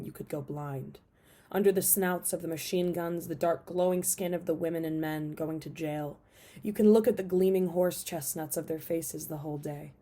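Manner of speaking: measured, emphatic poetry recital; poem reading. Acted. Reading a favorite poet's work to video viewers, emphatic poem reading. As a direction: read the poem out with punched line openings.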